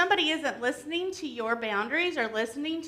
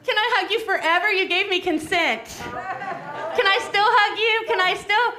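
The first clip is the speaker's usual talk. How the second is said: pushy grown-up; 5 semitones higher, 6 dB louder than usual. Acted. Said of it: "a grown-up comes up to you".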